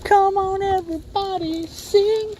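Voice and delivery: high, hyped-up call to sing along; rally the viewers, pitched high; cheerful high invitation; excited. Real, not acted. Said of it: "we are family".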